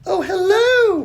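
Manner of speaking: put-on high bright girl voice; cheerful little girl. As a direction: greet him sweetly, girl voice. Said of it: "smiling back at the wolf".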